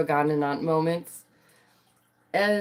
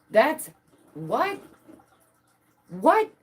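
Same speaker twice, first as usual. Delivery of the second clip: high incredulous what; outraged disbelief; 9 semitones higher, much slower than usual. Real, not acted. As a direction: yelp it in shocked disbelief.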